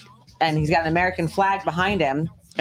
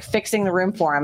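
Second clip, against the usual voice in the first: usual speaking voice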